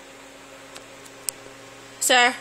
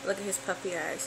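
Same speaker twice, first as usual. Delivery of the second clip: usual speaking voice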